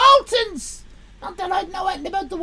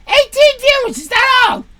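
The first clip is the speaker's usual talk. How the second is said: much louder, higher outraged cry; indignant shout; 6 semitones higher, 12 dB louder than usual. Real, not acted. Streamer outraged at only eighteen viewers, loud. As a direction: yell in mock outrage.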